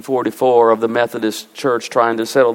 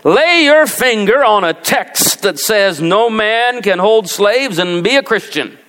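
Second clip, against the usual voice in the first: slow, higher mock Southern accent; 6 semitones higher than usual. Preacher mimicking a pro-slavery pastor, slow Southern accent. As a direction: drawl it like a Southern pulpit preacher. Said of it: "you gotta do it with a southern accent".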